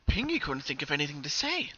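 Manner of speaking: usual speaking voice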